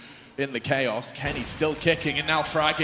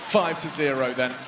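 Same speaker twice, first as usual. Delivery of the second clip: normal talking voice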